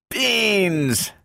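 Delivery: high, drawn-out wail; dismayed howl. A narrator's reading. Donovan opens a can hoping for better, dismayed howl. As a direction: howl the single word high, in outrage. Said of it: "howled Donovan".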